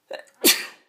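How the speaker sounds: sudden loud sneeze; a sharp real sneeze; sneezing. Real, not acted. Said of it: "I just sneezed there".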